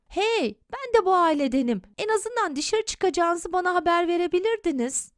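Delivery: put-on child objecting to a parent; indignant protest. A narrator's reading. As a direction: protest at being left out. Son protests to father on phone.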